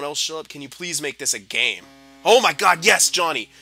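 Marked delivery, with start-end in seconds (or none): 2.2-3.5 real joyful shout, much louder and higher; ecstatic cheer. Real, not acted. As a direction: explode with relief at the goal. His player scores.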